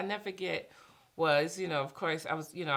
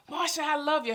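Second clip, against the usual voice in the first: high, loud shout of love; a loud call of her name; 14 semitones higher, 9 dB louder than usual. Retold or imitated. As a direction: shout it to break through her guilt. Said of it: "I heard this shout".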